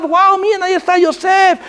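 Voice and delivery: high-pitched mock star-struck cry; imitated excited fans. Preacher voices girls spotting celebrity Yosef, mock star-struck. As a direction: call out like a fan spotting an idol.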